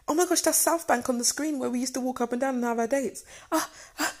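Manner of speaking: high, rushed gush ending in mock sobs; high-pitched, tearful amazement, acted out; retold losing it. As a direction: imitate being overwhelmed, racing into little cries. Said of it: "I've lost it".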